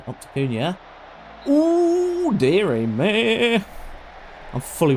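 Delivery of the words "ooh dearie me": surprised ooh. Real, not acted. Reacting to a chance.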